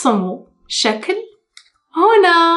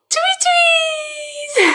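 very high squealing tweet; high bird cry. Pretend. Bird call climax.

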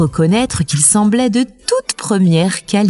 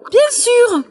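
réplique sèche de poule, très aiguë; réponds sèchement, dans l'aigu; ton sec. A narrator's reading. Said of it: "d'un ton sec". (mère poule au ton sec, voix perchée)

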